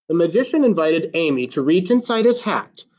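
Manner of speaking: ordinary delivery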